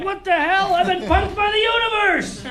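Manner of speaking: high, loud comic indignation; outraged shout. Acted. Comic finds he only got a movie role, outraged high yell. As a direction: cry out at being cheated.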